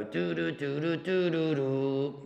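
buzzy "doo" from the top of the throat; kazoo buzz. Shown as a demonstration. Teacher showing the kazoo sound, buzzing doos. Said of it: "you make the same noise as that".

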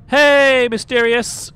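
loud, high, drawn-out greeting; hailing a viewer. Real, not acted. Streamer greets a viewer joining the chat, calling out. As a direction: call out loudly to a friend who just arrived.

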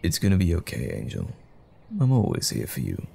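soft reassurance. Acted. Reassuring partner before work.

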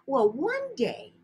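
ordinary delivery, nothing marked

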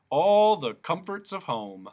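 slow, loud, sarcastic; mocking jailer. Jailer sarcastically shows Milo his bare cell, slow and loud.

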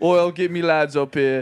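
high, loud mock British accent; a hammed-up British accent. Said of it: "me accent".

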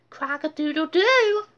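high, loud toy crow; toy duck crow. Acted. Talking duck toy crowing at D W. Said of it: "said Crackers".

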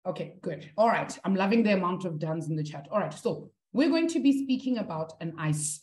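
usual speaking voice

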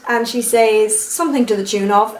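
ordinary talk

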